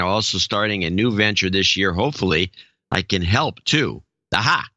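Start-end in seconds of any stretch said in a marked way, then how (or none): none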